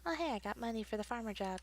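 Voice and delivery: normal talking voice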